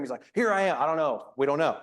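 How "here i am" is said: higher, eager Here I am; relieved eager answer. Retold or imitated. Abraham answering the angel's call, relieved and eager. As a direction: blurt it out in sudden relief. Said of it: "a big sigh of relief".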